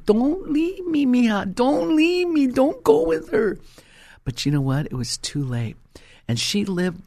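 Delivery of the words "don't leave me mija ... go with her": high, far-off wailing plea, slowed; a mother's anguished cry after her daughter; imitating a mother crying in the street, pitched high. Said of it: "my mother run down the street crying".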